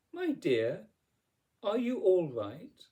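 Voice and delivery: a hint of a kindly fairy godmother; concerned fairy. A narrator's reading. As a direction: ask with fussy concern, ending on a lift. Fairy godmother checking on Cinderella.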